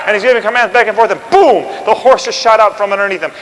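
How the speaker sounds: ordinary talk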